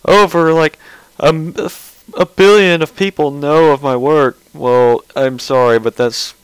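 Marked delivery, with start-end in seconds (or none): 0.0-4.4 mock Chris Chan quote.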